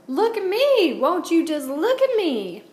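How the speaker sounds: high, loud gleeful whoop; whooping holler. A narrator's reading. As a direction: holler it out, showing off the new boots. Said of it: "she whooped and hollered".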